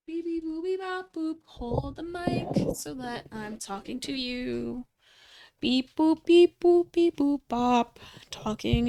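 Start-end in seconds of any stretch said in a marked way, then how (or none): 0.1-1.4 robot beeps. Pretend. Playful robot beeps at mic. 5.6-8.0 robot beeps. Pretend. Beeps into mic, louder.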